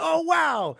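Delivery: high, loud delighted gasp of a wow; mock wow. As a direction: squeal it like a happy surprise. Retold delighted reaction.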